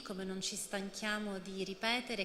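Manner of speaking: ordinary delivery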